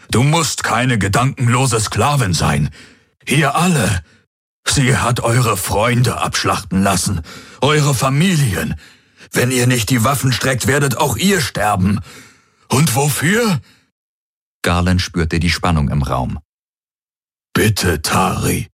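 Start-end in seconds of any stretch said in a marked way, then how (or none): 0.1-13.6 higher-pitched, urgent rallying plea; impassioned appeal. A narrator's reading. Hawks to guards facing him, impassioned appeal. 17.5-18.7 slow, dark-toned plea; pleading. A narrator's reading. Hawks stepping toward Tari, slow pleading.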